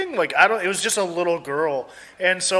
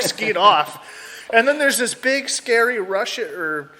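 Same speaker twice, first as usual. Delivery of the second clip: ordinary delivery, nothing marked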